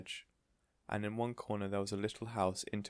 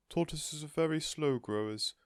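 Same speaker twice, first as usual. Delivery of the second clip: slow, grave voice; solemn; 7 semitones higher, 3 dB louder than usual. A narrator's reading. Mister Hoppy informing Missus Silver, solemnly. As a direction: speak gravely like an expert. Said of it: "Mister Hoppy said solemnly".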